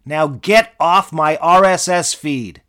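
angry outburst. Acted. Mitch yells at Darberius to stop interrupting, angry shout. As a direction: blow up and shout the assistant out of the way.

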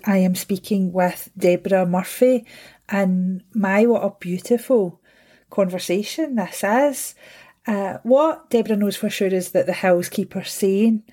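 ordinary talk

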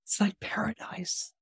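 breathy hushed whisper of wonder; awed whisper. Retold or imitated. Conway whispering about Shangri-La in awe. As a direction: whisper it like you can't believe your eyes.

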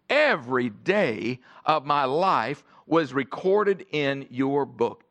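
slow, pondering each word; slow reflective reread. Shown as a demonstration. Pastor rereading the verse for the church, slow and reflective. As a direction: let every word sink in slowly. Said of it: "You just stop and think about that".

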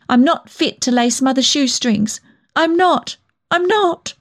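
high, upset self-reproach; near tears. A narrator's reading. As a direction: protest and berate yourself, close to crying. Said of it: "I was near to tears".

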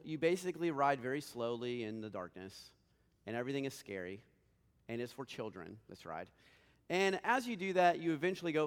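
ordinary delivery

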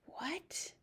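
soft breathy whisper. A narrator's reading. Pong whispering to headmistress, stunned whisper. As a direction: barely whisper it. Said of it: "whispered Pong".

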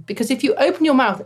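usual speaking voice

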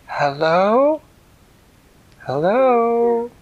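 calls out loud and high into a cave; calling out. A narrator's reading. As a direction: shout hello into the dark.

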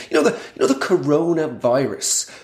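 stand-up comic drawing out the catchword; milk the word slowly for a laugh; repeated catchword, stretched out for effect; Michael McIntyre. Acted. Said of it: "doing this Michael McIntyre thing".

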